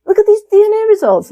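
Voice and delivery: high, quick, innocent re-enactment; imitating one's own clueless cheer. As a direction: pitch it way up, all naive. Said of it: "when I innocently was like".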